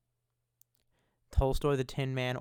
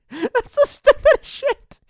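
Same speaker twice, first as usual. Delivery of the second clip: laughing, much higher and louder, slowed; laughing disbelief; 13 dB louder, slower than usual. Retold or imitated. Telling chat about the museum phone, laughing disbelief. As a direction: crack up in disbelief. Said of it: "I'm like".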